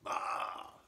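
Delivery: a sudden breathy cry of fright; startled yell. Acted. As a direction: jump and yelp in surprise. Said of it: "the intruder yelled".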